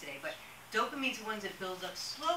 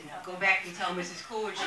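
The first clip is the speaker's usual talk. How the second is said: louder, laughing through the punchline; 6 dB louder than usual. Retold or imitated. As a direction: chuckle the last line out.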